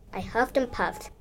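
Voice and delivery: usual speaking voice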